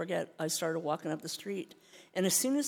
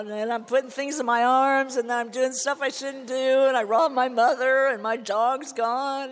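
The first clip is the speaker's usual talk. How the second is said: louder, higher rush of confession; tumbling out; 6 semitones higher, 10 dB louder than usual. Retold or imitated. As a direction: let it all spill out in a rising flood.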